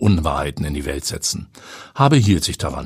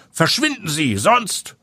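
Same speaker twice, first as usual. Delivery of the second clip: threatening watchman; 10 semitones higher than usual. A narrator's reading.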